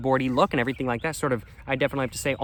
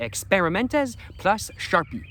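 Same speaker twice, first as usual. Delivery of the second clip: slow, higher-pitched French accent; 7 semitones higher, slower than usual. Shown as a demonstration. Vlogger reading the French slogan, attempted French accent. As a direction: draw it out in amateur French. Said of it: "under that in French".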